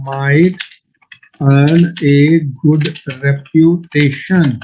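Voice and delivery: very slow, word by word with pauses; slow dictated example. Shown as a demonstration. Teacher giving students an example sentence, very slow dictation. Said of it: "इसकी example ये है".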